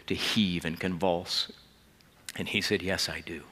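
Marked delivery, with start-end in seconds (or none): 2.8-3.4 choked tearful answer. Retold or imitated. Patrick convulsing with sobs.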